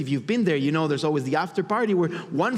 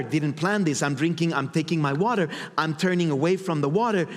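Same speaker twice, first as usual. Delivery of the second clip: ordinary talk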